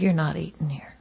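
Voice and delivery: very soft, low waiter voice; near-whispered, retold refusal; hushed refusal. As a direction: imitate a waiter discreetly turning someone away. Waiter quietly refusing service.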